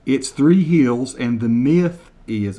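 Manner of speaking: slow, deliberate over-enunciation of each word; slow mock translation. Shown as a demonstration. Translating Kevin's Scottish for viewers, slow and deliberate. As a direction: spell it out slowly for Americans, deadpan. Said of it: "translate word for word".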